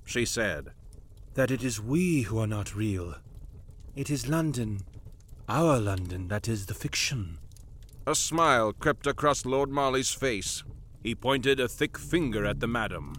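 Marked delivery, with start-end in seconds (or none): 1.3-7.4 low, soft and ominous; grave, hushed. Acted. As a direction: reveal the dark truth quietly. Vaquelin's grave revelation.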